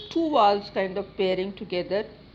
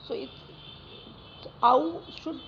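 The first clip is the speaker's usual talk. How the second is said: normal talking voice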